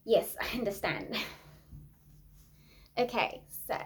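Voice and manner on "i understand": normal talking voice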